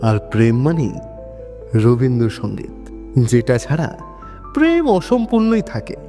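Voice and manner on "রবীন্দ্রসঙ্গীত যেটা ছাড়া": usual speaking voice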